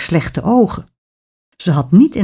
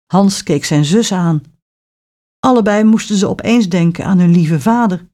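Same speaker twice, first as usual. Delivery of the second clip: ordinary delivery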